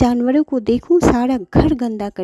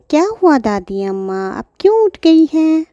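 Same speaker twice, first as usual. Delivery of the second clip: put-on higher childlike reply; child voice; 7 semitones higher than usual. Pinky answers her mother, higher childlike voice. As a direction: answer like a little girl.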